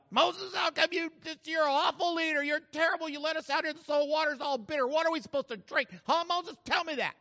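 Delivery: high, rapid-fire hammed-up complaining; mock griping. Israelite griping to Moses about bitter water, mock outrage. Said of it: "I had just been griping to Moses".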